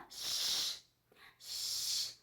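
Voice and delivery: "X" as an unvoiced shh sound; shushing X. Shown as a demonstration. Teacher showing children the X sound. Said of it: "O X tem um sonzinho assim".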